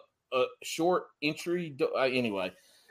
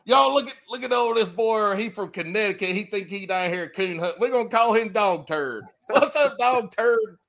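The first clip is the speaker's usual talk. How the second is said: imitating country locals; 4 semitones higher than usual. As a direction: holler it like a teasing good ol' boy. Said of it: "or they'd look at you, go".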